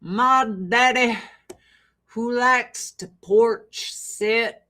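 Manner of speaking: slow Arkansas drawl, vowels stretched; deep South accent. Retold or imitated. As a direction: imitate a slow-talking Southern daddy. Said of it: "is very slow".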